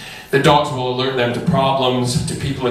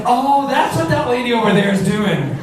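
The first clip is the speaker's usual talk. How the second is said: realization; 10 semitones higher than usual. Retold or imitated. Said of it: "I'm like".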